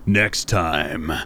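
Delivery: booming slow monster truck rally ad. Pretend. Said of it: "turned into Monster Truck".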